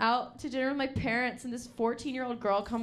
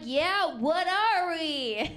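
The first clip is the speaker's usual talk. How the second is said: 1 mock needy; 6 semitones higher, 4 dB louder, much slower than usual. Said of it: "I'm like".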